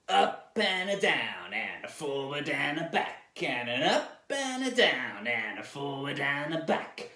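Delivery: drawling cowboy with swooping pitch. Shown as a demonstration. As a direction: stretch it out like a cowboy. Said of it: "do it with your best cowboy voice".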